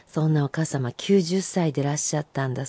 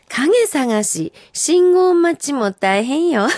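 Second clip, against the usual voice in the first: very slow, high, louder reading; haiku recital; 8 semitones higher, 4 dB louder, much slower than usual. Acted. Host reading a listener's summer haiku, slow. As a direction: stretch out every word.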